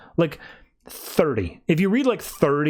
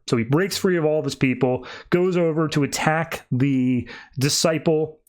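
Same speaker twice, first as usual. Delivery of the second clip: ordinary delivery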